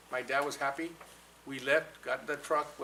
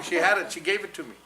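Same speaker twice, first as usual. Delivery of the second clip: ordinary talk, nothing marked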